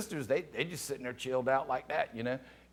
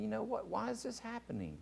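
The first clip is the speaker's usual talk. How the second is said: high, soft wondering question; hushed inner thought; 7 semitones higher, 10 dB quieter, faster than usual. Retold or imitated. Calm child watching everybody crying, hushed puzzlement. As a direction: think it aloud, small and puzzled. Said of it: "I'm thinking".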